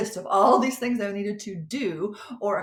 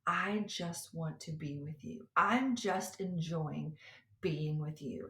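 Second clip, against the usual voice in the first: soft, slow whispered reassurance; tender whisper, slow and loving; God gently inviting her to slow down, whispered; gentle voice of God; 5 semitones lower, 7 dB quieter, slower than usual. Retold or imitated. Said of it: "the Lord just so gently was like".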